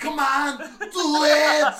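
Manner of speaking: loud, high excited shout; shouting egging on. Acted. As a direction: egg them on loudly. Robot urging, acted.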